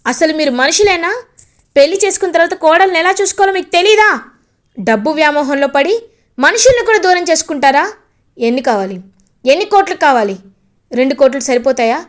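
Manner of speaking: building furious taunt. Acted. Brother taunting greedy in-laws about dowry, building angry shout. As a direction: throw each bigger sum at them harder. Gagan mocks in-laws' greed.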